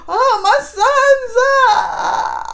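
very high, slow, loud sobbing cry; mock wailing. Blind king crying out for his sons. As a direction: howl for your sons in hammed-up grief. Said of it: "You know how he's doing".

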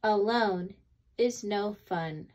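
usual speaking voice

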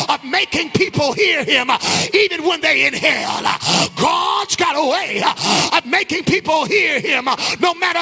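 sing-song shouted sermon; full-force preaching. Acted. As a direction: preach it out to the back row.

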